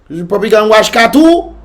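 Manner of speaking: normal talking voice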